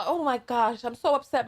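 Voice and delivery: ordinary talk, nothing marked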